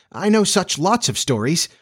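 high, eager persuasion; entreating. A narrator's reading. Wendy tempts Peter with stories, entreating. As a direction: coax in a high, pleading voice. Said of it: "she entreated".

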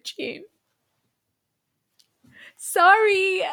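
a real catch in the throat on the wife's name; holding back tears, then a high, loud apology; voice breaking. Real, not acted. Podcast host, moved while telling of the wife, voice breaking. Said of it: "this is emotional".

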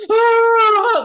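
high loud wailing sob; imitated ugly cry. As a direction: burst into an ugly, howling cry. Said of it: "ugly as hell".

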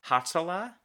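"Hatzalah" tried out with a high, questioning rise; tentative pronunciation attempt. Real, not acted. Host attempts an unfamiliar ambulance corps name, tentative pronunciation. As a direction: say "Hatzalah" unsure, lifting the pitch at the end. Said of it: "I'm gonna try this one too".

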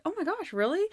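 high-pitched mock disbelief; acted oh-my-gosh amazement; flattered surprise. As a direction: gush with pleased astonishment. Acted reaction to a compliment.

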